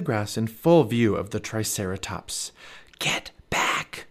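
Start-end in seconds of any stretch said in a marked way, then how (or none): 3.0-4.1 urgent whispered warning. A narrator's reading. Jack warns his sister near the Triceratops, whispered. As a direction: hush it to a whisper, drawn tight. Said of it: "whispered Jack".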